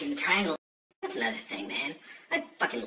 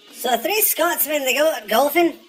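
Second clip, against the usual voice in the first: much higher, louder put-on voice for the whole joke; joke-telling voice; 11 dB louder than usual. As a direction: tell the Scotsmen joke in a high, loud comic voice. Joke told in a held put-on voice.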